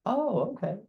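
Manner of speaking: high rising "Oh, okay" of recognition; sudden recognition, pitch jumping up; surprised realization. Retold or imitated. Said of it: "that, "Oh, okay," kind of moment".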